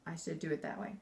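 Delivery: low, quiet firm command; stern, low-pitched correction; stern order. Shown as a demonstration. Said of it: "instead of".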